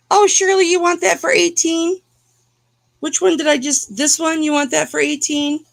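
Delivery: high, bright excited asking; high excited questions to Shirley; excited call. Real, not acted. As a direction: lift up, excited to sell.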